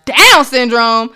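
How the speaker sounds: loud, stretched-out outraged question; indignant yell. Retold or imitated. Mother retelling her yell at the doctor, indignant. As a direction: throw it back in disbelief, loud and slow. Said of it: "I yelled at him like that".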